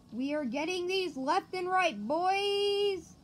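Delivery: gleeful bragging shout; hyped boast. Real, not acted. Hitting credits.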